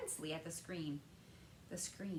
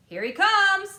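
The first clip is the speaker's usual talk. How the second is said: higher, much louder yell; excited yell; 13 semitones higher, 18 dB louder, slower than usual. A narrator's reading. Someone spotting the boy on screen, yelling.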